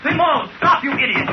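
high, urgent yell; shouting after him. Acted. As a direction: call him back, desperate. Lindley chases the fleeing Simon.

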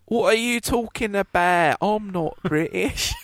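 mock Cockney; Cockney accent. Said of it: "by doing a Cockney accent".

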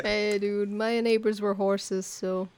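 usual speaking voice

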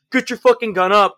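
imitated high, loud command; range-bully yelling. Instructor imitating people who harassed him on the range, mock yelling. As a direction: yell it like a pushy range guy. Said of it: "when people have done that on the range".

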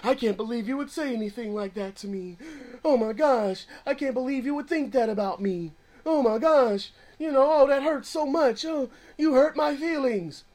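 lightly voiced mock offense, oh my gosh; mock hurt feelings. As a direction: play the crushed, offended friend. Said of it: "people's feelings are easily crushed".

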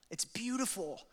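lifted, joyful exclamation; rising wonder. Real, not acted. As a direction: burst out with delight. Marvelling at the psalm.

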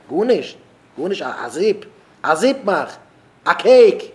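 loud, high mock complaint; exasperated outburst. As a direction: punch each short phrase in acted exasperation. (אויסגעשפּילטער אויפֿגערעגטער אויסגעשריי)

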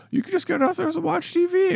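high coaxing voice; urging son. Retold or imitated. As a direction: coax the kid lightly.

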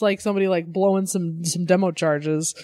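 normal talking voice

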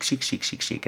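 soft whispered chic chic chic; whispered repeats. Real, not acted. Praising the classy store in a playful whisper. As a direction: whisper the word over and over.

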